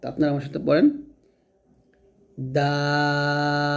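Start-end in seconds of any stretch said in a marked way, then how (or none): 2.6-3.8 দাল with a light d and the vowel held four beats long; tongue tip at upper tooth roots, stretch দাল evenly; thin, prolonged দাল. Shown as a demonstration. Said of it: "চার আলিফ টান দিব". (পাতলা করে চার আলিফ টানে দাল)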